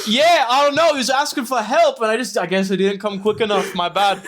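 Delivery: high, loud, shrugging guard voice; careless guard. Retold or imitated. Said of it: "this random guard being like".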